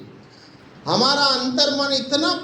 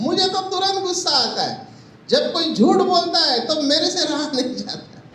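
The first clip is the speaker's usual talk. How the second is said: higher, faster mock grumble about instant anger; imitating a frustrated complainer; 7 semitones higher than usual. Visitors telling the speaker about their anger, in a higher, faster mock grumble. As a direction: play the visitor who can't hold his temper. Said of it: "तो मुझे क्या बोलते हैं".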